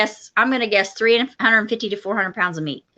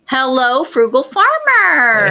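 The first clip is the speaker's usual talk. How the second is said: sing-song greeting; 4 semitones higher, 4 dB louder, slower than usual. Acted. Greeting viewer on live chat.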